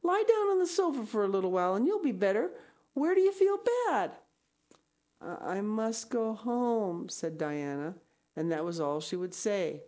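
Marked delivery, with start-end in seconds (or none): 0.0-4.2 quick, rising, anxious pleading; imploring. Acted. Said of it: "implored Anne". 5.2-7.1 slow, woozy, higher voice; dazed insistence. Acted. Sick Diana repeats her refusal.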